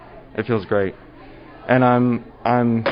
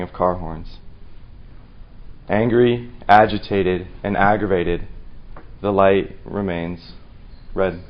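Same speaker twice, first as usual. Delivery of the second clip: deliberate delivery with long dramatic pauses; slam poetry recital. Acted. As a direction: open forcefully, then let the phrases settle. Student recites slam poem.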